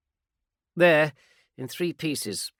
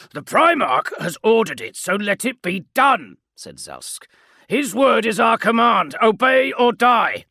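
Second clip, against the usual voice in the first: stern sergeant; 12 semitones higher, 12 dB louder than usual. Acted. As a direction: hammer home obey or die. Sergeant commands obedience.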